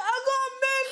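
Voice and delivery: high, loud wail through laughter; hammed-up helpless cry; mock wailing. Sick girlfriend answering shouting boyfriend, mock wail.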